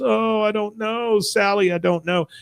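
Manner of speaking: high, drawn-out mock lament; mock distraught. Peggy to neighbor Sally about the gnome, mock distraught.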